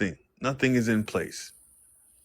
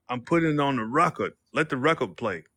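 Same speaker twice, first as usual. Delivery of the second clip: ordinary talk, nothing marked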